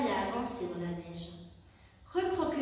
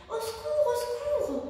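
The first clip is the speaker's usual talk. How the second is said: very high, louder panicked cry; yelling for help; 12 semitones higher, 5 dB louder than usual. A narrator's reading. Hedgehog sliding down the icy slope, screaming for help. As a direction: scream for help as you slide away. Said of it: "hurla-t-il".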